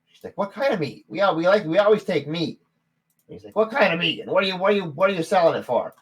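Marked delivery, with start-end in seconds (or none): 0.4-2.6 high, loud gnome lady voice; a suspicious innkeeper wife; female gnome. Acted. 3.6-5.9 high, louder gnome voice; gnome barkeep. Acted.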